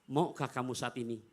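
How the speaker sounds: soft, low and loving; tender call. Retold or imitated. Prophet gently calling his wife, soft and loving. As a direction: gently address a beloved wife. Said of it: "Rasul panggil".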